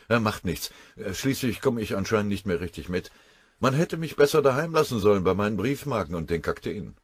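put-on a touch of a wounded old colonel; lightly voiced colonel. Colonel defends himself to his general, a touch hurt.

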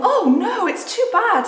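high, loud distressed wail; storybook girl wailing through tears; acted sobbing. A narrator's reading. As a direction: cry it out in grief. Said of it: "said, sobbed Lucy".

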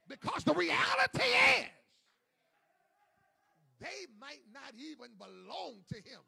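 loud hammering sermon delivery; preaching at full force. Acted. Preacher to congregation, full-force shouted preaching.

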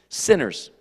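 usual speaking voice

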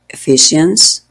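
"Ephesians" with the ph as a clear F sound. Shown as a demonstration. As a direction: say "Ephesians" with an F, not a P. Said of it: "el sonido de esas dos letras juntas es F".

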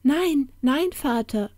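put-on high child-giant's protest; little giant calling. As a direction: pipe up to stop father. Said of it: "rief einer der kleinen Seeriesen".

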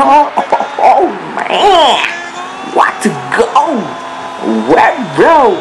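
high, excited play-by-play call; mock sports commentator. Gamer narrating his striker's run as a commentator. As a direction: hype it up like a TV commentator.

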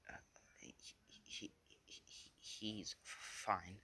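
soft, halting stutter; hushed, mock-nervous hesitation; nervous stammer. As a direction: stumble over the first word as if covering up. Nervous cover-up when questioned.

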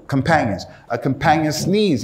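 usual speaking voice

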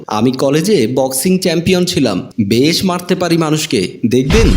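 ordinary delivery

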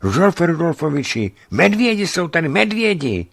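high, loud breathless cry; breathless boy. A narrator's reading. Wet boy announcing bears to narrator, breathless high cry. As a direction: gasp it out running in. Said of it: "celý udýchaný spustil".

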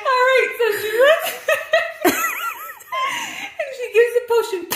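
loud, high, fast through laughter; laughing hard. Real, not acted. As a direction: gasp it out laughing. Teller cracking up.

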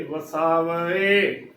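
ਵਸਾਵੇ slowly as "va-saa-va-e"; correct four-syllable reading. Shown as a demonstration. Teacher spelling out the word, ਵਸਾਵੇ as slow "va-saa-va-e". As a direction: stretch a separate final e on ਵਸਾਵੇ. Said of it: "ਈੜੀ ਨੂੰ ਲਾਵਾਂ ਏ".

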